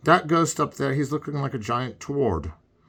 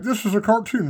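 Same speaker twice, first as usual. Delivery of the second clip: lightly mocking, higher pitch; mocking quote; 10 semitones higher, 3 dB louder than usual. Mocking the partner's line in a higher voice. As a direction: toss off the line as a playful jab. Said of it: "you're up there going, like".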